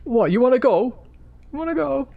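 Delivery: hammed-up high-pitched taunt; high mock challenge. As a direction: squeak out a dare to fight. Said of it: "his voice was really high pitched".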